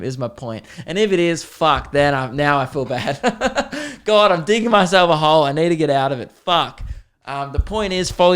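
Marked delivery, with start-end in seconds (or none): 2.8-5.4 laughing embarrassed. Real, not acted. Laughing at own awkward rant.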